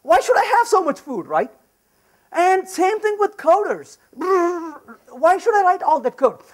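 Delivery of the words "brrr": long trilled "brrr"; imitated rattling noise. Speaker likens coders to over-serving restaurants, rattling brrr. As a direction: trill it like a machine churning away.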